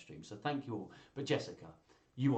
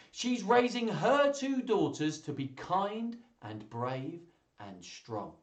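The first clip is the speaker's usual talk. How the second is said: put-on slow, animated reading to children; story read-aloud; 3 semitones higher, 4 dB louder, slower than usual. Reader voices a family taking turns to boast, animated storytelling. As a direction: lift the quoted lines high, drawing out key words.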